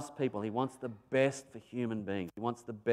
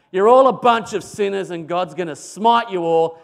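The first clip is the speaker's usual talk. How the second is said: loud, ranting doom prophet; soapbox preaching; 5 semitones higher, 12 dB louder than usual. Pretend. Reluctant prophet on his soapbox, ranting loud. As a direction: thunder it at the sinners. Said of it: "on his soapbox saying".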